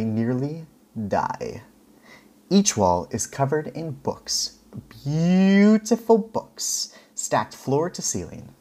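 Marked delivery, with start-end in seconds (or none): 5.0-6.9 very slow, high, marveling; breathless awe, much higher and slower; awed. Acted. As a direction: stretch it out in wonder. Narrator awed by book-lined walls.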